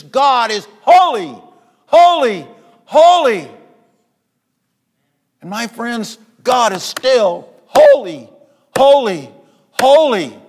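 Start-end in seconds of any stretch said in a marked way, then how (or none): 0.0-3.5 rising higher and louder on each holy; building shout of holy. Acted. Preacher proclaims the angels' cry. 6.4-10.4 slow, higher and louder declaration of holy; slow preaching shout. Acted. Preacher proclaims God's holiness.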